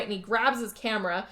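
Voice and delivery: ordinary talk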